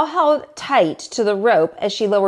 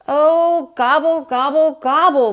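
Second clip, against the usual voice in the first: higher, louder wailing gobbles; wailing turkey; 5 semitones higher, 4 dB louder than usual. Acted. Turkey to Cow, wailing gobbles of despair. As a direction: wail in mock despair. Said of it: "wailed Turkey".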